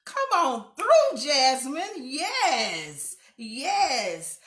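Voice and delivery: a loud, high cheer stretched out slow; hype cheer. Real, not acted. Hyping up Jasmine's self-praise, loud high cheer. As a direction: cheer her on like she's walking a runway.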